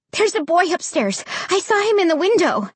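high-pitched, urgent rush; breathless report. A narrator's reading. Child telling Mom about the boy, slightly breathless urgency. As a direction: tell it high, as if short of breath. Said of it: "struggling to catch my breath".

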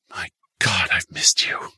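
breathless, words coming in gasps; panting. Acted. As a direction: pant it out, out of breath. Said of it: "he panted".

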